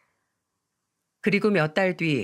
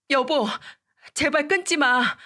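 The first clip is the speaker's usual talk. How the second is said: high-pitched begging ex-wife, far higher; desperate plea; 7 semitones higher than usual. Retold or imitated. 전처가 전화를 끊으려는 남편에게 매달리며, 높은 목소리로 애원. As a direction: plead with him not to hang up.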